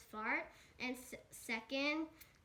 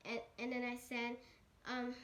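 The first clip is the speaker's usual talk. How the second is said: usual speaking voice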